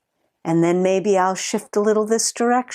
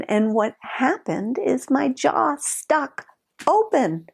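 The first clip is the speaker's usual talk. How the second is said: usual speaking voice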